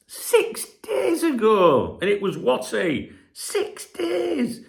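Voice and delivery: mock disbelief. Real, not acted. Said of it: "Tight fuckers".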